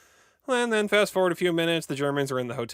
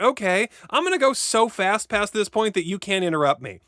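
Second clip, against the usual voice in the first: higher and louder, fed up with being interrupted; annoyed, higher, louder rant; exasperated outburst; 8 semitones higher, 5 dB louder than usual. Real, not acted. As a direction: snap back in mock exasperation. Interrupted while summarizing, exasperated.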